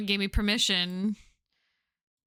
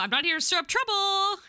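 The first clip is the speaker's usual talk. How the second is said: pitched up, climbing higher to the end; high-pitched protest; 10 semitones higher than usual. Acted. Newcomer assuring the pub regulars, high-pitched. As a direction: raise the voice high as you insist. First take of repeated game line.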